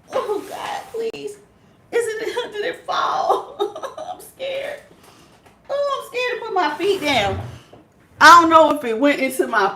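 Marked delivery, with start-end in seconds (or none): 0.1-7.4 high, hushed, laughing fearful pleading; scared whimper. Real, not acted. Hunting the lost spider, she whimpers half-laughing in fear. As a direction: pray under your breath, giggling in fright.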